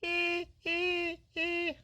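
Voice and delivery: high, thin squeaky koala call; koala squeak. Pretend. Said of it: "they go like".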